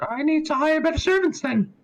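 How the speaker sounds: high, haughty noblewoman; high lady voice. Acted. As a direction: sigh about the staff primly.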